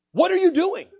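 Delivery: high, loud, insistent challenge; pressing question. Retold or imitated. Preacher presses God's question on congregation.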